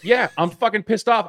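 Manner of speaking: high, exasperated mock fury; hammed-up pissed-off rant; mock angry outburst. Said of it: "we're like".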